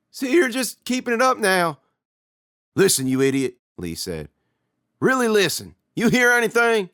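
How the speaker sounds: high, loud and urgent; tense argument. Acted. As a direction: press him to listen. Brothers arguing tensely.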